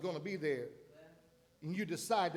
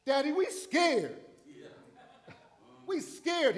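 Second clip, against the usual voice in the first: scared disciples; 8 semitones higher, 6 dB louder, slower than usual. Retold or imitated. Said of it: "him waking Jesus up".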